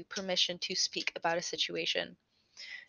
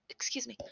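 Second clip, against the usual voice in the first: real coughing, then a strained excuse me; coughing fit; 4 semitones higher, 7 dB quieter, faster than usual. Real, not acted. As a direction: cough hard into the mic. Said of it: "apologize about coughing into the mic".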